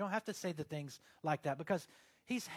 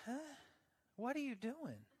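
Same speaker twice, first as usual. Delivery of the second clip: high-pitched mock bewilderment; baffled; 7 semitones higher, 6 dB quieter than usual. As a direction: react as if thrown by someone's odd praying voice. Baffled reaction to praying accent.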